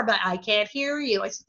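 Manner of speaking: ordinary talk, nothing marked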